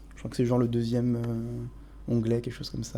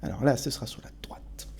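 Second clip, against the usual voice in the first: whispering; 3 semitones lower, 6 dB quieter than usual. Real, not acted. Guiding quietly near the alien.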